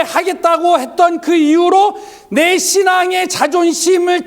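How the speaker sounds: high, insistent sermon delivery; impassioned preaching. Acted. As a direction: preach it with rising intensity. Preaches at full force. (열정적으로 외치는 설교)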